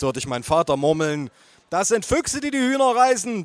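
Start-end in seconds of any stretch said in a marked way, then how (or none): 1.7-3.4 much higher, hammed-up bellow; mother yells her correction over everyone; shouting. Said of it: "brüllte meine Mutter".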